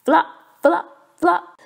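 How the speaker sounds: loud, high bouncy flop flop flop; flopping sounds. A narrator's reading. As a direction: bounce each flop like a clumsy frog.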